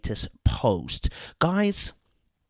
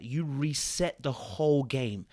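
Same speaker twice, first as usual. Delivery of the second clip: normal talking voice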